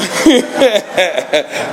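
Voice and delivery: high chuckle at himself; real laugh breaking out; amused laugh. Real, not acted. Admitting amends with my wife, amused laugh.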